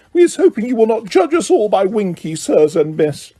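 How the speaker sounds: a lightly voiced ashamed elf; an ashamed elf apologising to the visitors; squeaky elf. A narrator's reading. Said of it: "squeaked a nearby elf".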